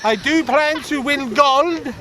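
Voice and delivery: lightly put-on Norwegian accent; a hint of a Norwegian accent; mock Norwegian accent. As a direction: play a bumbling Norwegian skier. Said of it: "like a Norwegian Frank Spencer".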